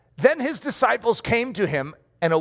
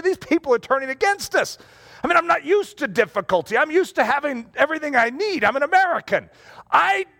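higher, indignant mock whine; whiny complaint; 6 semitones higher than usual. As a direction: complain like a spoiled American. Mock complaint back to Jesus.

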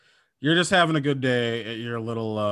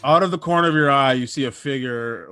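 ordinary talk, nothing marked

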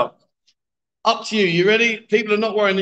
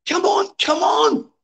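high coaxing; 12 semitones higher, slower than usual. Real, not acted. Coaxing player to hit ball.

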